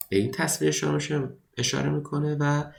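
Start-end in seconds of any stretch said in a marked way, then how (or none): none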